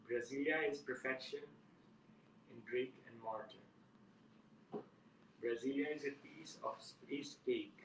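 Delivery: measured recital, pausing after every line; poetry reading. Acted. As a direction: let each line stand alone before the next. Resumes reading his poem.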